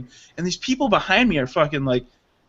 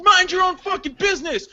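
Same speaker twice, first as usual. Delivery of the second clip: imitated heckling lady; 11 semitones higher than usual. Retelling the lady snapping back at him, high screechy imitation. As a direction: throw it back in a shrieky high voice. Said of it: "She's like".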